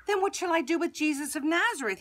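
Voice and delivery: quick, high, loud question; shocked. A narrator's reading. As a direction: blurt it out, pitch jumping up. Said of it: "Pilate was shocked".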